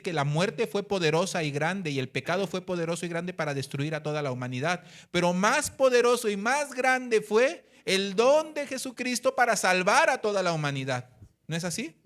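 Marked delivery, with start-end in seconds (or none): 5.1-11.1 loud, higher, slowed proclamation; preaching emphasis. Acted. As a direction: build it up to the glory of the gift. Preaching climax on Christ's gift. (proclamación fuerte y elevada del predicador)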